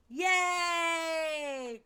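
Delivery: high, bright shout of joy; real excited yay; happy cheer. Real, not acted. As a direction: cheer at the finish. Cheering after finishing the song.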